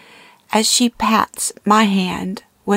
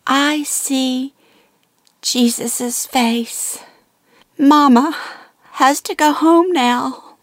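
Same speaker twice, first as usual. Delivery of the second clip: put-on higher, slow and distant, a frail old mother seeing beyond; dying mother's vision; 7 semitones higher, slower than usual. A narrator's reading. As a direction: speak as if already half gone, gazing past everyone. Dying mother sees Jesus' face.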